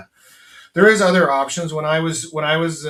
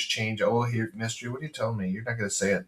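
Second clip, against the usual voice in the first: usual speaking voice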